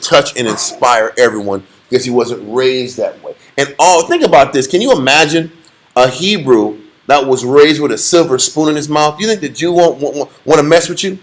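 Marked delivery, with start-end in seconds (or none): none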